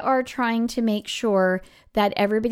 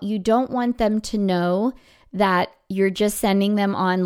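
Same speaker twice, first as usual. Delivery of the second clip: normal talking voice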